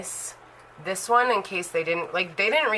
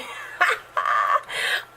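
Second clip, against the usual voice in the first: breathy laugh; 9 semitones lower than usual. Real, not acted. Laughing at own repeat.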